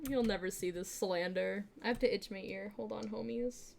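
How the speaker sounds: ordinary talk, nothing marked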